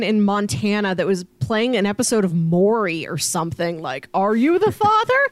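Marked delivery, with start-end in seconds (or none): 4.1-5.3 talk show host. Retold or imitated. Maury show host quote.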